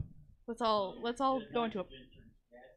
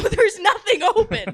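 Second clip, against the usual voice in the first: laughing high and loud; 10 semitones higher, 8 dB louder than usual. Real, not acted. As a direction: blurt it out through a laugh. Laughing while explaining her plan.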